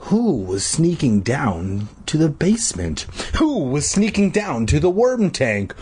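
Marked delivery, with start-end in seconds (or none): none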